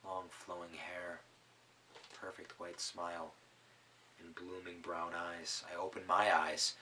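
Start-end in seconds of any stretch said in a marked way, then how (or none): 0.0-5.6 soft, slow, lovestruck; faint, lovestruck murmur; dreamy hush. A narrator's reading. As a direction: sigh it like a fading dream. Fainting hero recalls princess dreamily.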